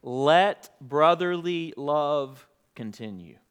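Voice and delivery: slow, solemn reading of the verse; measured pulpit reading; scripture reading. Acted. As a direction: read it with reverence, slowly.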